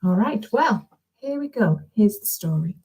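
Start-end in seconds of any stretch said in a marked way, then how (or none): none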